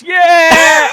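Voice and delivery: high, loud mock cheer; Nessa's late cheer. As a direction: imitate a lone belated cheer bursting into silence. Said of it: "and then you hear Ness".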